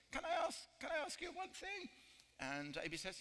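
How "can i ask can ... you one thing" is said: the parrot shouts its question, pitched way up; high-pitched bird shout. Retold or imitated. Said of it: "And the parrot says".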